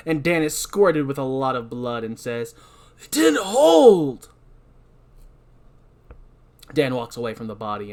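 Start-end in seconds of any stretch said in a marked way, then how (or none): 3.0-4.3 high, slow, loud and upset; dismayed and drawn out; Dan voice. Acted. Dan voiced in table read.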